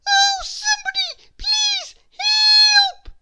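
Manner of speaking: very high, loud squeaky cry for help; tiny shriek. A narrator's reading. Miss Tiny cornered by Ginger, shrieking for help. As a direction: shriek with all her little might. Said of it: "shrieked Miss Tiny at the top of her voice".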